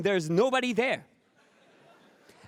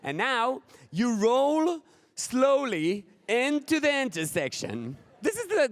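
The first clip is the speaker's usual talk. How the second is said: normal talking voice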